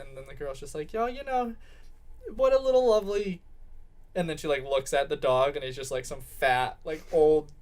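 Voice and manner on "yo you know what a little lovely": high-pitched hammed-up gushing. Girl looking at the dog she just bought, gushing. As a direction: coo over it in a raised pitch.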